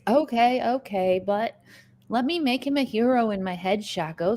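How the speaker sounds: ordinary talk, nothing marked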